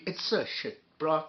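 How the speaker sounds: normal talking voice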